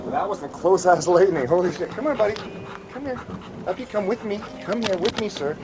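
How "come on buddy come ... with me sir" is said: higher, soft pet talk to a scared puppy; soft higher voice for a frightened dog; coaxing the dog. Real, not acted. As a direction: coax him up gently.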